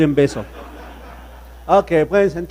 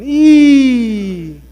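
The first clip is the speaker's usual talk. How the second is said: a sudden high, much louder cry; loud high yelp; 8 semitones higher, 11 dB louder than usual. Acted. (grito agudo y fuerte)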